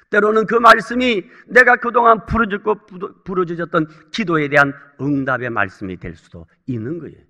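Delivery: usual speaking voice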